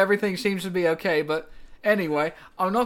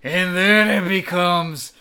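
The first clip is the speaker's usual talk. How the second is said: mock British; 4 dB louder, much slower than usual. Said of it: "do a British accent".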